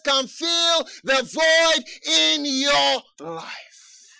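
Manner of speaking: measured start swelling into forceful proclamation; building preaching. Real, not acted. Preacher assures searching listeners only Jesus fills the void, building fervour. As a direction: build steadily to a ringing assurance.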